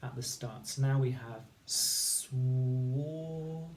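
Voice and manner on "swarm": swarm stretched very slowly, sound by sound; slowly stretched phonics sounding. Shown as a demonstration. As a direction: segment swarm s-w-ar-m. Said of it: "we're just gonna add s".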